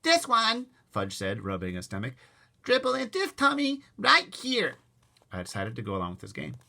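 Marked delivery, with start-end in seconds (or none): 0.0-0.7 put-on high, loud little-kid voice; proud little kid pointing to himself; toddler voice. Toddler showing his tummy, kid voice. 2.6-4.8 put-on high, loud kid voice; toddler voice. Toddler boasting to his brother, kid voice.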